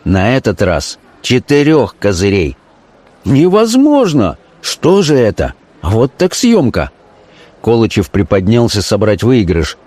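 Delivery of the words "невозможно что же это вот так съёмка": high, drawn-out disbelief; acted amazement. A narrator's reading. Gamblers at four trumps in a row, amazed outcry. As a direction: cry out slowly in high astonishment.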